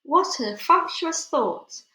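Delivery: plain reading voice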